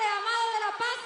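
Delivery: normal talking voice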